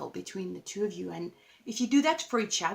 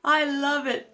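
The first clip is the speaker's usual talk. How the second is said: excited delight; 5 semitones higher, 8 dB louder, much slower than usual. Real, not acted. Delighted at drawing the card.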